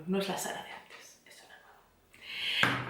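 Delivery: soft slow whispered reveal. Retold or imitated. Telling her friend she is a new Sara, whispered. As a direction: whisper it like a secret.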